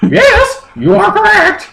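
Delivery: very high, laughing Pokemon voice; squeaky falsetto through giggles; high creature voice. Acted. Narrator voicing Moltres to Justin, high and giggly.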